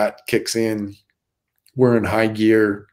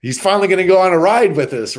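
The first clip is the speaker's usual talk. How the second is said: higher and fast, eager retelling; excited; 7 semitones higher, 4 dB louder, faster than usual. Retold or imitated. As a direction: rush it out like giddy news. Crew thinks Keanu will ride.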